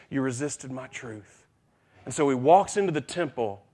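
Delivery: usual speaking voice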